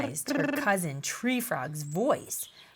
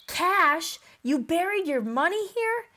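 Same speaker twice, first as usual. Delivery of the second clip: surprised question; 8 semitones higher, 4 dB louder than usual. Acted. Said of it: "questioned Timberdoodle".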